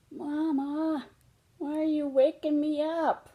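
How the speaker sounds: a small cub woken in the dark; groggy little cub. Acted. Little cub woken before dawn asks Mama why, childlike.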